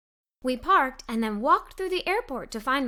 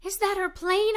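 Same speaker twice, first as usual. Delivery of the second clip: hopeful question; 6 semitones higher than usual. A narrator's reading. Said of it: "I asked with hope".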